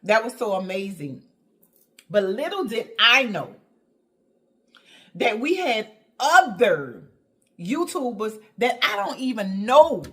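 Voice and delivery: ordinary delivery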